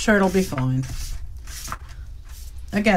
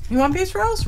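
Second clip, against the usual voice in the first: talking to dog; 11 semitones higher, faster than usual. Real, not acted. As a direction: coo at the dog.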